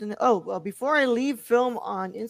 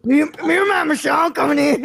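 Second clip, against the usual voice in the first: gruff intruder voice; 6 semitones higher, 8 dB louder than usual. Acted. Mocking intruder voice.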